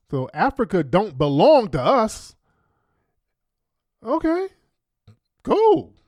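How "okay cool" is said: high, sarcastic "okay"s; mock agreement. Real, not acted. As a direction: pretend to give in, dripping sarcasm. Sarcastic reaction to her clip.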